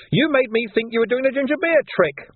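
high, loud whining accusation of "ginger beer trick"; high whining "ginger beer trick" falling at the end; whiny snitch. Acted. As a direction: whine it out in a high voice.

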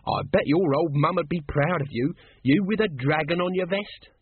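high, lively conversational teasing; conversational needling. A narrator's reading. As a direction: needle him as if making conversation. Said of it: "said Nobby conversationally".

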